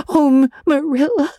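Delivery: put-on high-pitched tearful girl, catching on the name; sobbing. A narrator's reading. Anne lies weeping on the bed, sobbing. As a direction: sob the name out. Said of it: "sobbed Anne".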